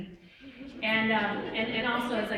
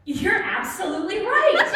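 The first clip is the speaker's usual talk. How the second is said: laughing; 9 semitones higher, 9 dB louder than usual. Retold or imitated. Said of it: "to which I'm like".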